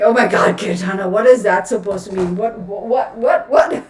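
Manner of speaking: loud, shocked outcry; mock viewer outrage. Viewers shouting shocked at her remark, loud mock outrage. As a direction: yell it like a scandalized viewer. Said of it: "I know you're going to be like".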